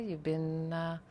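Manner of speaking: ordinary talk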